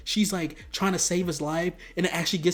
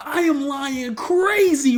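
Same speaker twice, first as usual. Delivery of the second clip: loud, high, stretched-out declaration; hammed-up hype; 8 semitones higher, 6 dB louder, slower than usual. Vlogger bragging about lying for a crush, loud high hype. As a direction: holler it slowly, milking every word.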